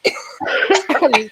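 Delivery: a real rough cough; coughing fit. Real, not acted. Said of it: "Sorry, guys, I'm, like, coughing my head out".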